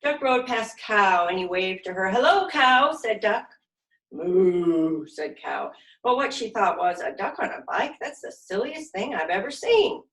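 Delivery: put-on lively, expressive read-aloud for little kids; animated children's story narration; storytime reading. A narrator's reading. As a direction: read it like a silly picture book to a class. Reading picture book to children.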